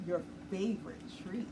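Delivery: usual speaking voice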